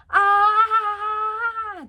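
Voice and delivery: loud high strained sung ah; squeezed sharp note. Shown as a demonstration. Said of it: "When I was squeezing here".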